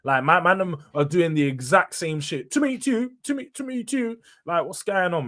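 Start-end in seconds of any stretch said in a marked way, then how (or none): 2.5-4.2 much higher sing-song back and forth; mocking two midfielders as the Chuckle Brothers; Chuckle Brothers impression. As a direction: bounce the lines like a kids' TV double act. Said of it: "Chuckle Brothers".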